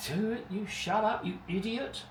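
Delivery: high put-on voice, telling yourself off; playful high-pitched self-rebuke; mock self-scolding. Scolds himself mid-sentence.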